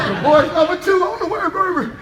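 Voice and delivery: high, loud gleeful laugh and cheer; whooping with glee; gleeful cackle. Retold or imitated. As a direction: crow with delight at the news. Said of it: "I'm like".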